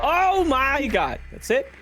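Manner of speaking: high, slow, loud amazement; drawn-out thrilled gasp; excited. Real, not acted. Drone finds the bomb.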